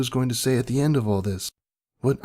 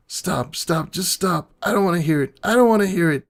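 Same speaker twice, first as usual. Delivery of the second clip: desperate pleading; 7 semitones higher than usual. Acted. Narrator pleading not to hear the memory again.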